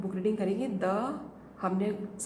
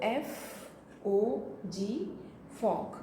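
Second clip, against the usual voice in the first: very slow letter by letter; stretched-out spelling; 4 dB quieter, much slower than usual. Shown as a demonstration. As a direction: spell it for a dictation. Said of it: "हमें लिखवाना है बच्चों को फॉग".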